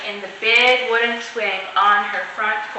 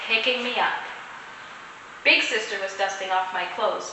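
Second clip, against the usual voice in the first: usual speaking voice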